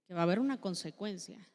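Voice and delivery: normal talking voice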